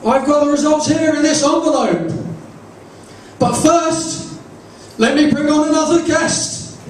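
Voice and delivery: high-pitched talk show host announcing the reveal; Jeremy Kyle. Acted. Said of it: "Jeremy says".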